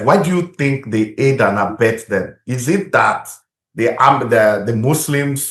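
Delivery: ordinary delivery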